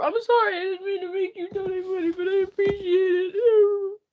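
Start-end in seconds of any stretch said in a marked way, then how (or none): 0.0-2.1 higher, louder, crying out sorry; tearful apology. Real, not acted. Streamer to chat, apologising while crying. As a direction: apologise through sobs.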